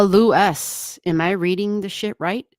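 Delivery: ordinary delivery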